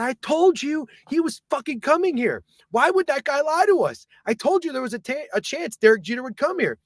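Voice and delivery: high, strained retort; reenacted high-pitched protest; exasperated I-told-you-so. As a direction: insist in frantic exasperation. Exasperated argument with Greg.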